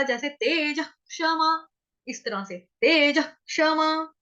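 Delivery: तेज क्षमा with stress falling on क्ष; तेज क्षमा, slowly stressed; natural stress. Shown as a demonstration. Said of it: "स्वाभाविक रूप से आघात आएगा".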